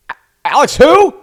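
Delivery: very high, loud startled question; mock baffled boss. Boss hearing an unknown caller's name, mock baffled squawk. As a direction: squawk it in exaggerated bewilderment.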